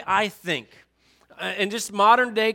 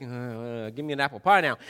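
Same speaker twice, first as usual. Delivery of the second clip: lower, flat uninterested order; bored Joseph; 8 semitones lower than usual. Retold or imitated. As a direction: tune her out and order dessert. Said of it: "and he's like".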